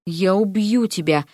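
plain reading voice

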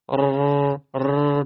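ر with a small, quick trill; fine rolled r. Shown as a demonstration. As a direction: roll the ر lightly.

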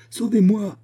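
desperate cry. A narrator's reading. Woman hanging over the torrent, crying out in terror. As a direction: scream for rescue as the branches give. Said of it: "s'écria-t-elle".

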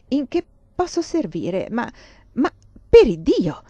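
rising in shock to a loud burst; a grand duke struck dumb by a lady; astonished exclamation. A narrator's reading. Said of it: "immenso stupore". (stupore del Granduca che esplode forte)

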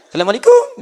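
put-on Indian accent. Preacher joking, greeting in a mock Indian accent. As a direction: greet like an Indian speaker, playfully. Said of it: "Orang India ketahuan".

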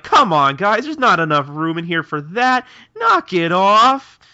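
loud, high-pitched scolding; exasperated mock yell. Shinpachi breaks up a fight in hiding, exasperated yelling. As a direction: yell to break up squabbling friends, last words drawn out.

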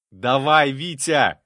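loud, high cheer; shouting. A narrator's reading. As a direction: urge him on with a shout. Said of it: "крикнул я".

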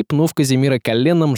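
ordinary delivery

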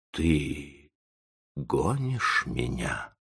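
soft, slow, faint murmur; soundless babble. A narrator's reading. Old professor realises he is thrown out, faint and faltering. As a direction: let it out slowly with almost no voice. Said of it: "беззвучно пролепетал Тотти".

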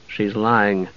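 soft, low accusation. A narrator's reading. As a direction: say it low, for one listener only. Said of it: "he said in a low voice".

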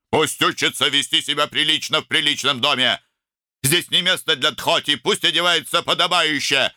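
high-pitched, insistent tirade; indignant lecturing. A narrator's reading. Older brother insisting on decent dress at home, indignant. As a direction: lay down the rules heatedly.

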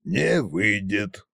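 low-key, slow dismissal; dismissive snort. A narrator's reading. Tsar rejecting his son's idea, dismissive. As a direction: drag it out sceptically. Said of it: "фыркнул царь-батюшка".

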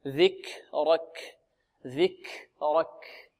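very slow "ذكرك", breath released after each ك; flowing breath on ك. Shown as a demonstration. Teacher demonstrating flow of breath, slow aspirated ك. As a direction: let air run out softly after ك in "ذكرك". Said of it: "هذا جريان النفس".

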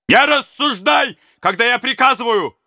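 crush the back talk; furious order. Acted. Prince shouts down the robber's back talk.

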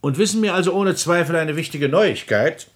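eager question. Acted. President eagerly pressing the marshal for news. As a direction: push him for the gossip.

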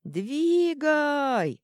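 high, loud gnome roar. A narrator's reading. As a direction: bellow the command to go. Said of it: "взревел". (громкий рёв)

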